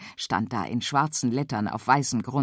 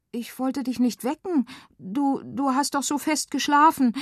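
guilty excuse; 10 semitones higher than usual. Acted. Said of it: "redete sich Feli mit schlechtem Gewissen heraus".

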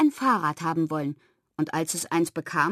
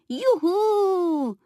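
high piglet whoop; long, high-pitched cry of joy; gleeful shout; 10 semitones higher than usual. A narrator's reading. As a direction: cheer like a toy pig setting off. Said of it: "rief es".

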